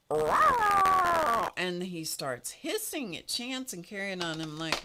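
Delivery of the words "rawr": loud high mock cat roar, much higher and louder; a sudden high hammed-up cat snarl. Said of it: "and he goes".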